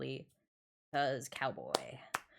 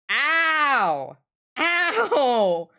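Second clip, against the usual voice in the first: sharp loud yelp of real pain; 9 semitones higher, 14 dB louder than usual. Real, not acted. Streamer yelps after hitting her knuckle, loud pained ow. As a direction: cry out as your ring cracks your knuckle.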